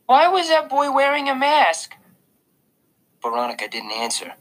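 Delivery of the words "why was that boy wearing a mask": put-on much higher, louder curious little boy; a toddler's innocent question; small child asking. A narrator's reading. Little brother asks the babysitter, high child voice.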